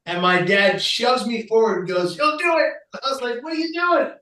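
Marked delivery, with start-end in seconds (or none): 2.2-2.7 much higher, pushy order; insist, no arguing; firm dad command. Retold or imitated. Father pushes his son to give the talk, insistent. 3.4-4.1 very high, alarmed question; startled protest. Retold or imitated. Son protests as his dad volunteers him, alarmed.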